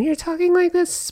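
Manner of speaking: high, feminine-sounding demo voice. Shown as a demonstration. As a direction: lift the pitch up and hold it there. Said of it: "their pitch can sound a little more feminine".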